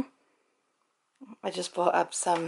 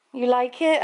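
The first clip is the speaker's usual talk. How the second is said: doggy talk; 5 semitones higher, 7 dB louder than usual. Real, not acted. Talking to the dog.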